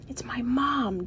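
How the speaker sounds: slow, voiceless whisper; silently mouthed. A narrator's reading. George to Annie as his mom arrives, whispered. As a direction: whisper it so only a friend hears. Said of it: "George mouthed silently to Annie".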